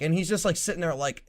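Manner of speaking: normal talking voice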